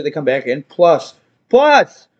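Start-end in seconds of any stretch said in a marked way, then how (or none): 1.5-2.1 raised-voice repeat. Retold or imitated. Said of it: "why are you yelling?".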